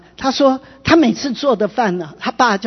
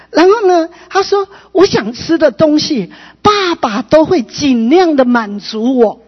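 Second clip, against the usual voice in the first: high, loud, emotionally charged testimony; impassioned preaching; 6 semitones higher, 4 dB louder than usual. Real, not acted. Preacher tells how the father changed, impassioned preaching. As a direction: preach it with a swelling, moved voice.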